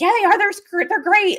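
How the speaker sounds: high, loud mocking of fans; mock fan insistence. Mocking commenters who insisted their team was great. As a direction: imitate overconfident fans. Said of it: "And they're like".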